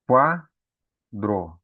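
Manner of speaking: Quadro with the u weak and the a strong; teacher modelling Quadro; syllable demo. Shown as a demonstration. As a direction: sound out Quadro for the class. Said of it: "a primeira sílaba tem o quá".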